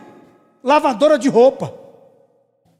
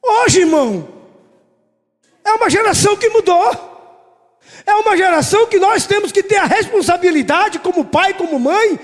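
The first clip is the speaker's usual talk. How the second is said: rally the church to its duty; fervent preaching; 8 semitones higher than usual. Acted. Preacher urges parents in the church, fervent exhortation.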